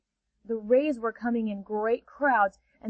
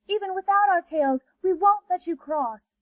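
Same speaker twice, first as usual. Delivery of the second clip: much higher stubborn ray voice; higher defiant rays; 7 semitones higher than usual. Acted. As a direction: defy her sweetly. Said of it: "said the rays".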